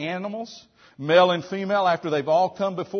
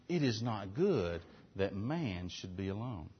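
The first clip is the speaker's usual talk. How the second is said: hushed, solemn voicing of God; 8 semitones lower, 11 dB quieter than usual.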